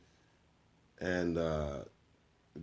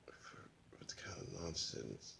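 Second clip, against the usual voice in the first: hissed, slower whisper; menacing whisper; 6 semitones lower, 15 dB quieter, slower than usual. Acted. Villain threatening Lobo with the flamethrower, whispering. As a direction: whisper the threat close to his ear.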